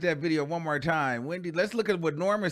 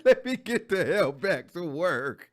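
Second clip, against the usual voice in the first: imitating Wendy; slower than usual. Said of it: "And she said".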